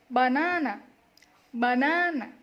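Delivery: BANANA with a loud, stretched middle NA; stress on second syllable. Shown as a demonstration. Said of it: "give a little press to the syllable".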